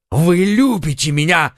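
lifted, eager exclaim; young lover's burst of joy; delighted outburst; excited cry. A narrator's reading. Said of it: "вскричал он".

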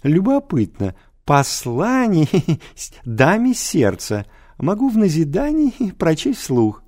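high voice broken by giggles; mocking teacher. A narrator's reading. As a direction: tease the pupil, laughing. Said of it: "залился он".

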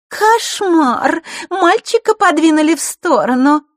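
very high, loud girlish mockery; mocking. A narrator's reading. Girl taunts a sidelined boy, mocking. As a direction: exclaim in mock horror, high. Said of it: "гаденько усмехнувшись".

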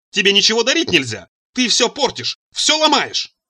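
high-pitched angry scolding, climbing; renewed shouting. A narrator's reading. Mother berates son for breaking gifts, shouting. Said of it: "закричала матушка с новой силой".

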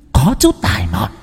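high, eerie possessed voice; taunting evil spirit. A narrator's reading. Spirit in the boy defies the shaman, high taunting voice. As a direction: dare them in a high demonic voice.

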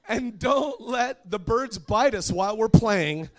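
high, slow, very loud mock plea; child's shouted prayer. Younger daughter finishing her showy prayer, high drawn-out shout. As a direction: holler each word out with childish zeal.

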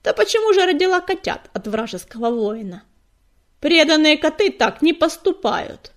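loud, jeering challenge; mocking call. A narrator's reading. Cat in the crowd accuses the leader, mocking. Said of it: "насмешливо крикнула с толпы Частокол".

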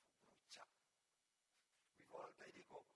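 ordinary delivery

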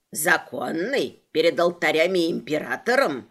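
low sly leprechaun. Acted. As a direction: play the pedantic leprechaun low. Leprechaun voiced by narrator.

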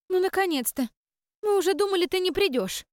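высокий радостный писк нечисти; пищать от радости, как маленькая нечисть; писклявая нечисть. Acted. Нечисть радостно встречает хозяйку. (нечисть встречает хозяйку, высоким радостным писком)

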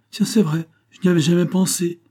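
acted innocent surprise; sudden realisation. A narrator's reading. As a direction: exclaim as it dawns on you. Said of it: "soudainement illuminé".

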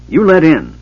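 high, loud angry demand. A narrator's reading. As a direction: snap the order. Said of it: "Bupu stated angrily".

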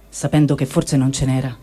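usual speaking voice

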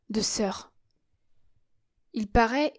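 usual speaking voice

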